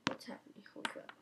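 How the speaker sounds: hushed whispered question; soft breathy-free whisper. Retold or imitated. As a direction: whisper it as if hiding. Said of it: "I was like".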